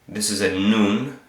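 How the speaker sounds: normal talking voice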